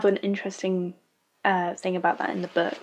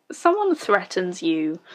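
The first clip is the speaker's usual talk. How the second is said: ordinary talk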